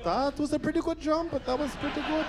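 high, uneasy praise of a losing jump; nervous coach voice. Retold or imitated. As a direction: pretend to be pleased while scared. Voicing the runner's coaches.

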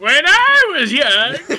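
put-on slow, high cranky old man; hammed-up geezer. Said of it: "Dumb fucking old man".

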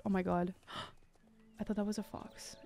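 gasp, then a whisper; startled whisper. Real, not acted. Gamer spooked by a fox-shaped flower, whispering. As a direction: hush it out after a jump scare.

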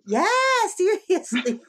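laughing through the word. Real, not acted. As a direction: laugh while saying it. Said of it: "laughs through the word".

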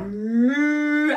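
drawn-out pretend moo; long spoken cow call. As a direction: imitate a cow for children. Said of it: "what animal goes moo".

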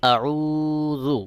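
আউযু with the উ drawn out; আউযু: long held vowel; one-alif lengthening. Shown as a demonstration. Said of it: "এক আলিফ টান দিয়ে উচ্চারণ করতে হবে".

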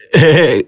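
acted grinning laugh. Triệu greeting Khải on the road, grinning laugh. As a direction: chuckle before hailing a friend. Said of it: "Triệu đã toét miệng ra cười".

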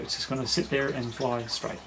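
ordinary delivery

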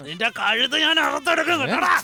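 furious threat. Acted. Uncle threatens in a quarrel.

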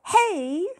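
high, loud startled yell. A narrator's reading. Walter yelling at the fairy yanking his tooth. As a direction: yell as your tooth is yanked. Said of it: "yelled Walter".

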